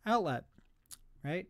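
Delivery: ordinary talk, nothing marked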